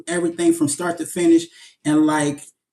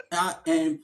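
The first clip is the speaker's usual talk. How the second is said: normal talking voice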